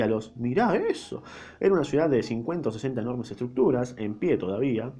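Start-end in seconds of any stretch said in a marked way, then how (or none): 0.4-1.2 high-pitched wonder; admiring exclamation. A narrator's reading. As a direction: point out a marvel in admiration. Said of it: "que se sumó a los". (exclamación admirada, en tono agudo)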